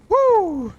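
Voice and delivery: excited cheer. Real, not acted. Loot cheer.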